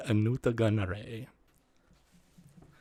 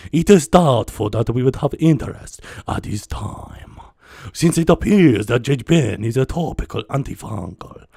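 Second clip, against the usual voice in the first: a lightly done, loud alien viceroy voice; a loose stab at the scheming viceroy reading a business letter; Viceroy Nute Gunray; 7 dB louder than usual. Acted. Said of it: "Viceroy Newt Gunray instead".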